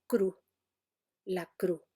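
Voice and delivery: "cru" with no final consonant; dropped final S. Shown as a demonstration. Teacher showing the S-dropping speaker. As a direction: cut "cru" off at the vowel. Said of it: "no dice cruz, sino".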